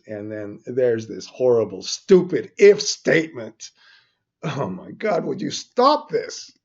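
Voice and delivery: building into a loud, high-pitched outburst; exasperated rant. Real, not acted. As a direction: rail at it, completely fed up. Ranting at a bad if statement.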